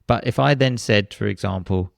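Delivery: ordinary talk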